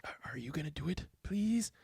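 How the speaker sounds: breathy whisper into an ear; hushed urgent whisper; a secret plea whispered close. A narrator's reading. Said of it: "Goldberg whispered in my ear".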